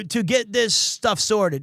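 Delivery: usual speaking voice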